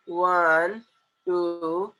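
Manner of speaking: drawn-out, lazy count; lax, loose counting. Shown as a demonstration. Teacher showing how not to count on fingers, slack and drawn out. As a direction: let each number hang loosely. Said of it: "ढिल्ले सुल्ले बन के".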